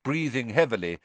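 plain reading voice

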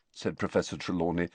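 plain reading voice

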